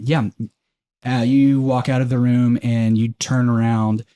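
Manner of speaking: normal talking voice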